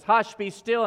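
loud, high hush to the storm; commanding call. Acted. Jesus again silences the storm, loudly commanding. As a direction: call out over the wind.

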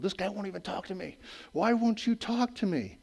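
frustrated ruler wanting an answer, lightly voiced; Pilate demanding. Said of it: "Pilate demanded".

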